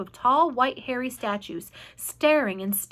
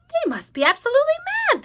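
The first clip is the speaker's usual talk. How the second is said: centipede scoffing; 6 dB louder, much faster than usual. A narrator's reading. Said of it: "the centipede said".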